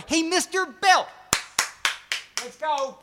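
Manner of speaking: high, drawn-out mock call. Calling on listener in put-on voice.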